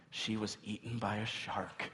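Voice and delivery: low, soft retold answer; subdued kids' answer. Kids telling dad about the mom's fate, subdued.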